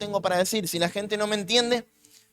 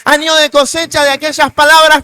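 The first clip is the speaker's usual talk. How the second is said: louder, rising preaching climax; predicación a pleno pulmón; 3 semitones higher, 10 dB louder than usual. Real, not acted. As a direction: proclaim the harvest with full force. Predicación a todo volumen. (predicando con toda la fuerza)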